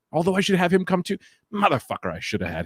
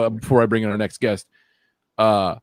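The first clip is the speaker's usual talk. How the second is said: usual speaking voice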